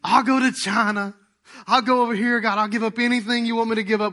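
loud, all-in promise to God, not truly crying; retold fervent prayer. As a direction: pour it out loud like the first surrender. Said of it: "I'm crying on the middle floor".

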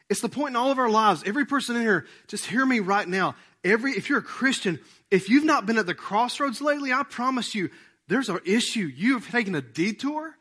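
loud, driving preacher's push; full-force preaching. Acted. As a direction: press it on the congregation, loud and urgent.

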